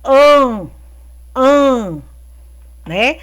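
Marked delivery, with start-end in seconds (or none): 1.4-2.0 AN sounded through the nose; nasal sound. Shown as a demonstration. Teacher showing pupils the tilde's sound, nasal "an". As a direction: hold a nasal "an". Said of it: "Nasal, que é um som que sai pelo nariz".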